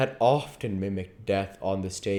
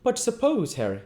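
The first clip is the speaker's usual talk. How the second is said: raised, lively character voices; character dialogue; 8 semitones higher, 4 dB louder than usual. A narrator's reading. Reader voicing Henry and Dorian in high dialogue.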